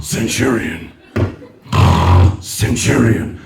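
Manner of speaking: very low, solemn drone; sleep-champion voice. Retold or imitated. Comedian mocking his wife's sleep award, low drone. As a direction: drop right down after a big breath. Mimics wife deep in meditation.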